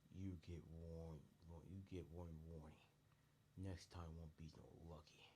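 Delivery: slow, drawn-out threat; slow villain voice, threatening; menacing villain warning. Acted. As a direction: stretch each word into an ominous warning. Villain Crumpus gives parting threat.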